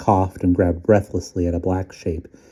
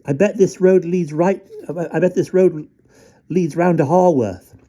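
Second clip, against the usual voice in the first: put-on high child voice; 11 semitones higher than usual. Max voice.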